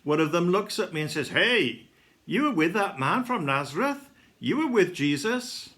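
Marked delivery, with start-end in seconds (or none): 1.3-5.7 sharp rise in pitch on questioning accusations; mildly raised accusing questions; challenging accusation. A narrator's reading. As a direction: call him out, voice raised and questioning. Serving woman challenges him by the fire.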